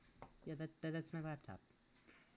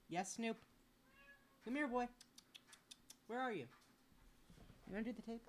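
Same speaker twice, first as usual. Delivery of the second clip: higher, coaxing call to a pet; sing-song pet call; calling the cat; 9 semitones higher, faster than usual. Real, not acted. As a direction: coax the cat out from under the table.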